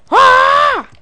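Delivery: loud, very high held "ah"; battle scream. Pretend. Player attacking in game, high battle scream.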